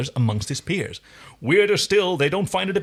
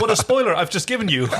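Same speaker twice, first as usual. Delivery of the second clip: laughing; 7 semitones higher, 4 dB louder, faster than usual. Real, not acted. Laughing at own accidental spoiler.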